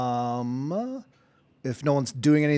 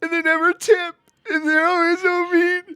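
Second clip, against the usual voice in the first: much higher, crying-voiced complaint; tearful; 4 dB louder than usual. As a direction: whine through tears about bad tippers. Barkeep tearfully complains of rich.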